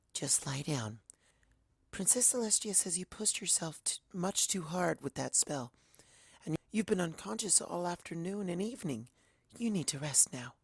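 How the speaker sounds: high, soft, soothing pony voice; gentle Fluttershy. Acted. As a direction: coax a frightened friend softly back to calm. Fluttershy tends to Twilight.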